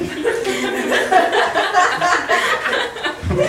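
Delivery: breathless high cackle; high wheezing laugh. Real, not acted. Comic cracks up at his own pen story, high wheezing laugh.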